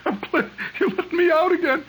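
high, giggling thank-you through laughter; laughing grateful plea. Retold or imitated. Patient thanks dentist, laughing high and breathless. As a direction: beg with relief while cracking up. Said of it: "cling to his knees and say".

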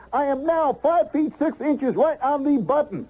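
high, slow triumphant announcement. Acted. Man proudly announcing his new height, high and slow. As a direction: savor every word of the win.

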